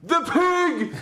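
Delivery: loud, very high shout; booming yell. Acted. Player booms a fake alarm with thaumaturgy. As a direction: yell it as a distraction from afar. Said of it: "I'm just going to yell".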